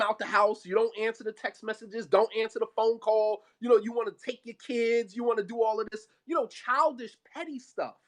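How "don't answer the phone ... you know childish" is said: normal talking voice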